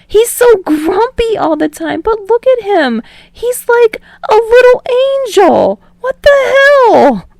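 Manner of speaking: high, loud giggly disbelief; barely holding back laughter. Acted. Teasing friend over a childhood clip, fighting back laughter. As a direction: squeal it, trying not to crack up. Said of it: "barely holding back his laughter".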